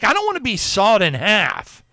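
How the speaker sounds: a hammed-up childlike protest; playful alarm at being sawn in half; mock horror. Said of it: "I'm like".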